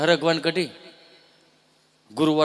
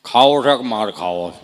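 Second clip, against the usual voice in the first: stern father threat; 5 semitones lower, 8 dB louder than usual. Retold or imitated.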